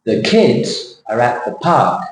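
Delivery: put-on KIDS and PARK stressed, falling, small words light; sentence stress shape. Shown as a demonstration. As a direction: beat out "kids" and "park", pause after "kids". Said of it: "The shape of the sentence". (เน้น "kids" กับ "park" เสียงท้ายลง)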